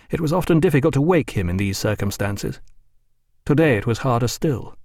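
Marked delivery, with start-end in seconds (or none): none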